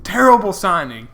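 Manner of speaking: imitating a loud, high-pitched fan's rant; megaphone heckler. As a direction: pitch it up and call out the complaint. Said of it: "make your voice really loud".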